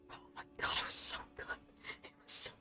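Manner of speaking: breathy whisper of delight; an awed whisper recalling a meal; whispered rapture. Real, not acted. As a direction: whisper it like a guilty pleasure. Savoring memory of kebab meal.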